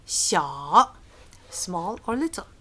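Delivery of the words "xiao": "Xiao" sinking low, then rising; low, emphatic dip on "Xiao" with a rising end; third tone, down and up. Shown as a demonstration. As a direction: scoop "Xiao" deep down and back up. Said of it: "down and up".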